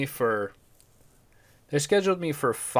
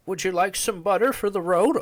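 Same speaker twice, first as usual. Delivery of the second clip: put-on high, sweet little-old-lady voice; 8 semitones higher than usual. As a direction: imitate a kind grandma asking politely. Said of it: "she goes".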